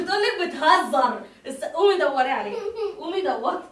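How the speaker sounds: loud playful teasing to her daughter; teasing laugh. Real, not acted. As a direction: laugh while daring her to look. Mother laughing, teasing loudly.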